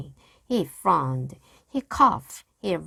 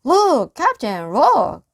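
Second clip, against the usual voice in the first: excited call; 7 semitones higher, 10 dB louder than usual. A narrator's reading. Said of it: "Pinky excitedly shows medallion".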